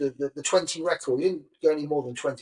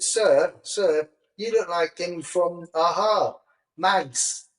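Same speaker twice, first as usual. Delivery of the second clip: higher-pitched, badly imitated Sheffield pupil; terrible Sheffield accent; 4 semitones higher, much slower than usual. Sheffield pupils addressing their Spanish teacher, terrible mock accent. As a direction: do a bad Sheffield schoolkid accent. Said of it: "That was a terrible accent".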